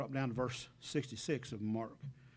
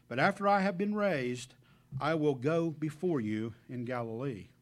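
louder, measured Bible reading; scripture reading; 7 dB louder than usual. Acted. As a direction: proclaim the verses to the congregation. Preacher reads scripture aloud.

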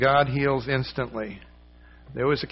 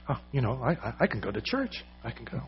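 softer, hushed voice of grateful wonder; quiet wonder; 8 dB quieter, faster than usual. Retold or imitated. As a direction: say it softly, almost to herself.

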